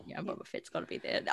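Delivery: hushed, breathy whisper. Acted. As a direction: whisper it close to the mic. Repeats line, whispered then loud.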